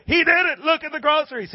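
high, excited holler; imitated overjoyed woman; excited insistence. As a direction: insist in a high, delighted cry. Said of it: "She goes".

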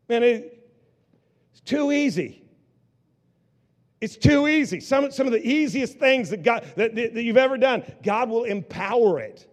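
loud, high, fervent sermon climax, slowing on the last lines; full-force preaching. Acted. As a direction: preach it out to the whole congregation.

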